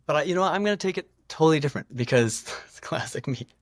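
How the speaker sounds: gushing with nervous energy; excited. Real, not acted. Excited self-mocking about bragging.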